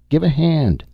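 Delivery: eager call. A narrator's reading. Tance calling for help.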